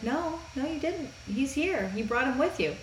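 high, soft Empress voice; lightly voiced gentle Empress. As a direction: reassure him, light and high.

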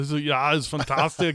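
lightly put-on Austrian accent; Arnold impression. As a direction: imitate an Austrian action star. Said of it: "I just turned it into Arnold".

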